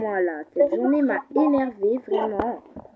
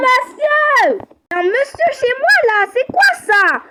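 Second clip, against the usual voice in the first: angry shouting; 6 semitones higher than usual. Acted. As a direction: yell at the intruder to get out. Stranger found inside her house.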